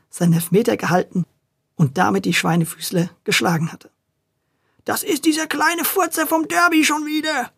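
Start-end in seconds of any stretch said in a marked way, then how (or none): 4.8-7.5 high, beside himself with rage; furious bellow. A narrator's reading. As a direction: rant in a fury. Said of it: "brüllte er ganz außer sich".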